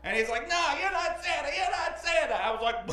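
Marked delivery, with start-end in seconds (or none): 0.5-2.3 put-on high-pitched protesting child; mock childish whine; upset first grader. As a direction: imitate a tearful little kid arguing back. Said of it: "immediately starts crying".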